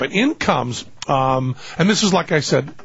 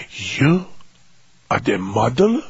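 disgusted question in mock Italian accent, long pause after "you"; pure disgust; 4 semitones lower, slower than usual. Makeup man eyeing the unlikely model, pure disgust. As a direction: ask it haltingly, appalled. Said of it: "it's just pure disgust".

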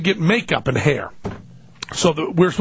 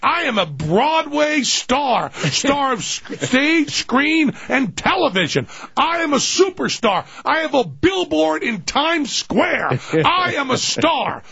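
high, slow, declamatory boasting; grand star proclamation; 10 semitones higher, slower than usual. Acted. Offended star announcing his greatness, high grand declamation. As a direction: proclaim each title grandly, stretched and pitched up. Acting a self-important star.

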